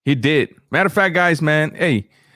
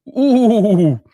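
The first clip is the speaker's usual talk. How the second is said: high, loud ooh of being impressed; ooh, that's bold; 7 semitones higher, 5 dB louder than usual. Real, not acted. Impressed reaction to bold prediction.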